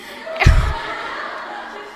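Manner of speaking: breathy, unvoiced giggle of amusement; amused breathy laugh; burst of laughter. Real, not acted. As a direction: let a real laugh escape. Laughs about the teacher's Indian-tone French.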